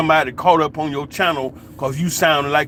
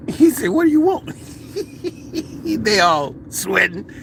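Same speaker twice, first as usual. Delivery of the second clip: high-pitched, laughter-filled storytelling; cracking up laughing; 12 semitones higher than usual. Real, not acted. Telling how the elder faced the film crew, cracking up. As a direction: struggle to tell it through real laughter.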